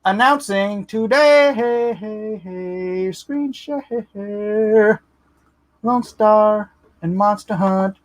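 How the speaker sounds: high, slow showman's announcing; hype announcement. Acted. Streamer unveiling his new card sets, hyped showman voice. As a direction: build it up like a big reveal.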